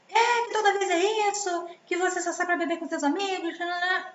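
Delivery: high, whiny nagging complaint; mock the wife scolding her husband. Wife nagging husband home from drinks, high mock whine. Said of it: "a mulher dando a falar".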